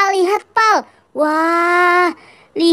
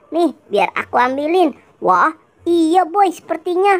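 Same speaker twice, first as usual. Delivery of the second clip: normal talking voice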